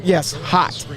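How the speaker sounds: ordinary talk, nothing marked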